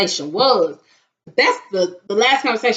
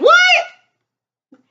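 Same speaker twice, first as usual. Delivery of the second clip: shrill high outraged "What?"; 5 dB louder than usual. Retold or imitated. Woken by a late-night call, high outraged disbelief.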